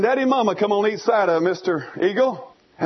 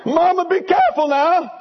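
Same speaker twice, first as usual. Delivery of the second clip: hollering eaglet; 10 semitones higher, 5 dB louder than usual. Retold or imitated. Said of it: "He's hollering for Mama to be careful".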